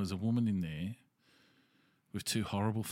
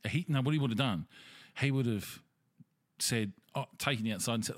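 ordinary talk, nothing marked

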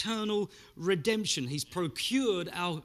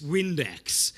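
ordinary delivery

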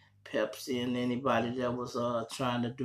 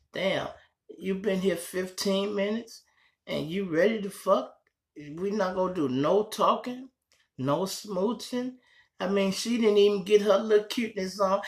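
mock woman; 8 semitones higher, 3 dB louder than usual. Voicing the woman's complaint.

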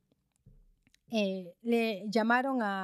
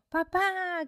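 put-on high, louder delighted call of a child; child's joyful greeting; 9 semitones higher, 5 dB louder than usual. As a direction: call out as a child thrilled to see Dad. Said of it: "lo espera con una sonrisa".

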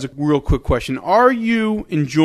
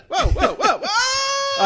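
high laughing shout; excited whoa; much slower than usual. Real, not acted. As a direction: cheer it, rising. Big play reaction, cheering.

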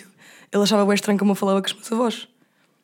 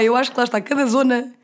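ordinary talk, nothing marked

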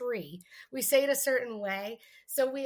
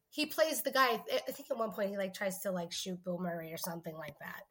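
ordinary talk

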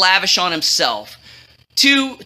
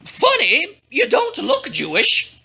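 higher-pitched puzzled remark; mild shock; 8 semitones higher than usual. A narrator's reading. Shocked king answering his queen, mildly puzzled. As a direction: lift the pitch in mild disbelief.

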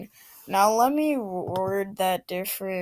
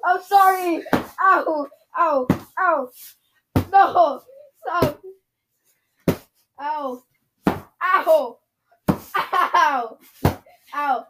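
high, loud pretend pain cries; 8 semitones higher, 8 dB louder than usual. Kid being hit with chancla.

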